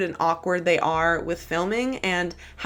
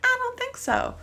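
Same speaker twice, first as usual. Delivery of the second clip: high sassy echo, a bit softer; sassy refusal; 4 dB quieter than usual. Real, not acted. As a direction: repeat the no, pitched up. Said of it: "repeated refusal".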